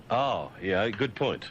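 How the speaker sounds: normal talking voice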